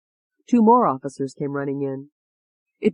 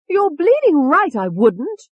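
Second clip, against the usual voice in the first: slow, loud, much higher cockney retort; Tony's voice; 12 semitones higher, 6 dB louder, slower than usual. A narrator's reading. Tony defying David, slow loud cockney retort. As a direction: drawl it back defiantly. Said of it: "Tony said".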